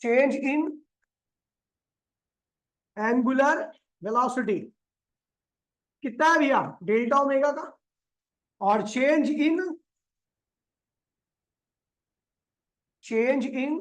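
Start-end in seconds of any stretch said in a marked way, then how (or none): 0.0-4.6 drawn-out, word-by-word dictation; slow dictation. Real, not acted. Dictating while writing on the board. 8.9-13.8 very slow, stretched-out words; slow dictation. Real, not acted. Dictating while writing on the board.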